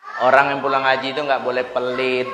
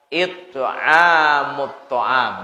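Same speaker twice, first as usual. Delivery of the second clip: very slow drawn-out Arabic phrase; slow solemn Arabic recital; slow recitation; much slower than usual. Acted. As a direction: stretch each syllable of the hadith. Prophet's answer recited.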